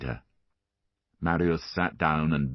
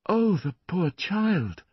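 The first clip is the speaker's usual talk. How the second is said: pitying; 13 semitones higher, slower than usual. A narrator's reading. Ursula sees bleeding wrist.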